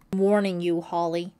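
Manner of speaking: loud, forceful threat; stern warning. A narrator's reading. John threatening Holly to back away, stern warning. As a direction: warn her off with real force.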